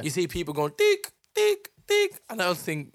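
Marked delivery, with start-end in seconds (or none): 0.8-2.2 high beeping checkout scanner sound. Retold or imitated. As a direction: beep like a till scanner. Imitating checkout scanner beep.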